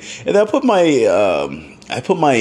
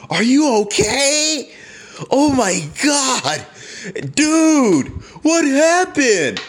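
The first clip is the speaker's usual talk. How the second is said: higher, slow, worried onlookers; concerned bystanders; 12 semitones higher, slower than usual. Retold or imitated. Pool guests asking the fallen man if he is okay, high worried. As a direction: ask like an alarmed stranger leaning over the pool.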